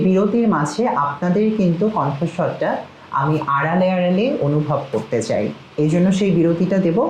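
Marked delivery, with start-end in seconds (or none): none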